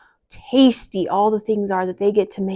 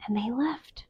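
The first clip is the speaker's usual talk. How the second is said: soft hushed narration; quiet whispered narration; whispered aside; 2 semitones higher, 11 dB quieter than usual. A narrator's reading. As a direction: drop to a whisper.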